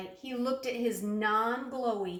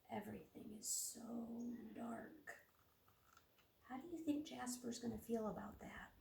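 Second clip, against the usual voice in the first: much softer, fast hushed questions; 2 semitones higher, 14 dB quieter, faster than usual. Real, not acted. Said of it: "hushed questions to kids".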